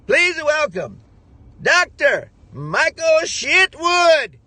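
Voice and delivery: high, slow, booming introduction with a drawn out Shitwood; grand announcer. Retold or imitated. Nigerian host grandly announces Michael on stage, booming and drawn out. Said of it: "He says".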